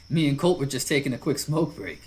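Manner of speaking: easygoing big lug giving an order; a hint of a burly biker calling to his buddy; big friendly biker. [name] chatting.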